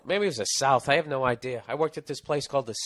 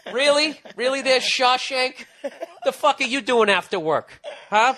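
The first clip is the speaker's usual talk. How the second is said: much higher and louder mocking jeer; sarcastic taunt; 11 semitones higher, 7 dB louder than usual. Comic ripping on the ex-con cook, sarcastic and much higher. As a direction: sneer it at him like a playground taunt.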